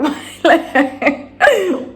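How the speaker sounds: a burst of high, helpless laughter; high rueful laugh. Real, not acted. To her viewers, laughing at her own panic: high helpless laugh. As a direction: laugh at the absurdity of it.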